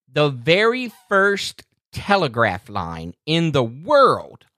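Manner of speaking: slow, drawn-out quiz-host read with long pauses; dramatic quiz question. Shown as a demonstration. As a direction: lay out "eighteen forty-four" slowly like a game-show host. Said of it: "All right".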